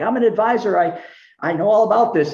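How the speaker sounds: high, self-assured boast; cocky brush-off. Retold or imitated. Telling Brent he knows it all, cocky and high. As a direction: play the know-it-all advisor.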